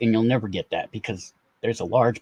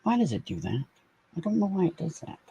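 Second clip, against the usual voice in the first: ordinary delivery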